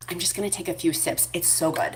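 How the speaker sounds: soft whisper aside. Real, not acted. Sparing sensitive viewers, whispered aside. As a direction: whisper it so as not to bother anyone. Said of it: "sensitivity towards people drinking".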